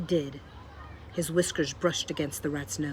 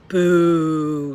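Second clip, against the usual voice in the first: much louder jeering boo; 12 dB louder than usual. A narrator's reading. Botticelli jeering the mouse with a loud boo. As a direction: jeer at him with full voice. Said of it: "shouted Botticelli".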